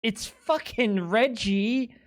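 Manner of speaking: normal talking voice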